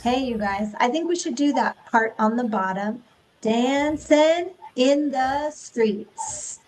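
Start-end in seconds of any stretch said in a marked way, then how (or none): 3.4-6.6 very slow, evenly paced; slow rhythmic say. Shown as a demonstration. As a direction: say it slowly to the beat. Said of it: "Will you say that with me".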